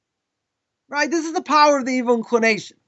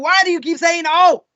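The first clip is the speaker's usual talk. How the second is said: raised, exasperated mock demand; acted upset; 4 semitones higher than usual. Upset person challenging the rabbi's answer, acted exasperation. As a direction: imitate someone fed up with the reply. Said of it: "So this guy gets upset".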